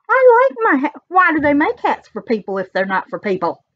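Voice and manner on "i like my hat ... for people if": high, loud insistence on I; petulant high I like my hat; defiant. Real, not acted. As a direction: stake your claim to the hat, squeaky high. Defending her hat.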